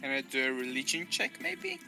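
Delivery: normal talking voice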